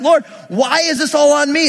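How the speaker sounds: high, acted complaint to God; exasperated Moses. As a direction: protest as if unfairly burdened, hammed-up. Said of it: "Moses is like".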